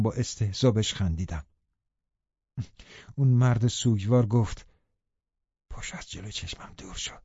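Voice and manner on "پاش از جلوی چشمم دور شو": low, much softer muttered order; grieving man muttering, barely audible; muttered under breath. A narrator's reading. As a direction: force it out under your breath. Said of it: "به نظرم اینو زیر لب گفت".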